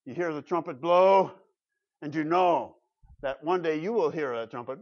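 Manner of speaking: ordinary delivery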